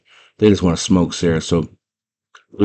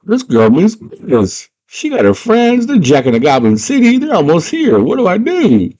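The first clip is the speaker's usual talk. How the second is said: rising high, slowish mock panic; Jared frets from his castle; panicked villain; 10 semitones higher, 3 dB louder than usual. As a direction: climb higher as the worry grows. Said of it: "He's like".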